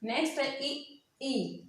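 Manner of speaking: ordinary talk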